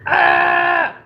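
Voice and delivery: high, loud wordless holler; loud yell. Real, not acted. Streamer warning viewers of a loud noise, then yelling. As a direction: belt out a sudden "ah". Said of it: "they're gonna hear a loud noise".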